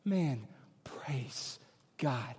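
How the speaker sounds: breathy slow whisper of praise. Real, not acted. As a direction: murmur it to yourself, almost unvoiced. Aside after quoting the hopeless.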